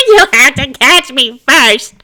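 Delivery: loud, high teasing taunt; gleeful taunt. Acted. Spirit taunting Gil on the way out, gleeful. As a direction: taunt him over your shoulder.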